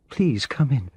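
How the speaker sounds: a high, trembling plea at the door; tearful invitation, voice wavering; shaky voice. A narrator's reading. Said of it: "she said in a shaky voice".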